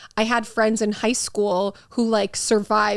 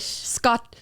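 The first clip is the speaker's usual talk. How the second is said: usual speaking voice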